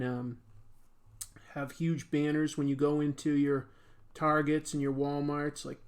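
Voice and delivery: usual speaking voice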